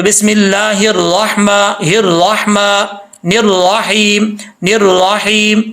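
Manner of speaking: बिस्मिल्लाहिर्रहमानिर्रहीम recited slowly with a full ra; heavy ra recitation. Shown as a demonstration. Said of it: "ये पुर पड़ा जा रहा है".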